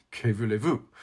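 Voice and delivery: put-on lightly French-accented shrug; French phrase. Moore's words quoted, a hint of French accent. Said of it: "Moore would say".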